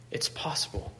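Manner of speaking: "It's possible" breathed out as a soft whisper; Paul's whispered reassurance to the church; whispered echo. Acted. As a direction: repeat it under your breath. Echoed as a whisper.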